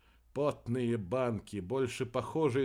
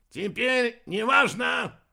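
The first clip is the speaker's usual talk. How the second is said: high, slow, booming announcement; loud proclamation; 10 semitones higher, 7 dB louder, slower than usual. A narrator's reading. Serb drowning all sounds for miles, proclaiming loudly. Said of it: "провозгласил серб".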